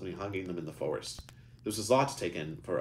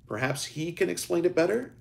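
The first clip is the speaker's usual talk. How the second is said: cheerful; 12 semitones higher, 6 dB louder than usual. A narrator's reading. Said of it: "Eric said with a cheerful smile".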